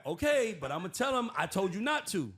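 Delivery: high, loud, laughing concession; mock Trump. Host mimics Trump agreeing, high and loud. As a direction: give in with a laugh. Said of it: "Trump reply".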